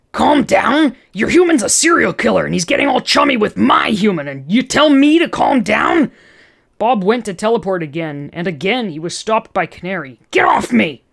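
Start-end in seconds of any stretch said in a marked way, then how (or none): 0.1-6.1 loud, high outraged yelling; furious, shouting it back; enraged. Acted. 10.3-11.0 angry shout. Acted. Bob shoves away.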